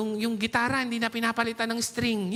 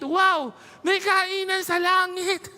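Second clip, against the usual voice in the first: high, loud, slowed amazement; excited exclamation; 8 semitones higher, 5 dB louder, slower than usual. Real, not acted. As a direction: marvel out loud at heavenly feasting. Joking about eating in heaven.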